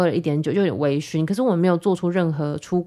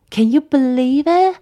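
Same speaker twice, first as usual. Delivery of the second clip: high, loud, slow cry of disbelief; incredulous; 8 semitones higher, 7 dB louder, slower than usual. Real, not acted. Podcaster to listeners, a loud stunned disbelief. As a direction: stretch it out, aghast at yourself. Exclaims disbelief at her past.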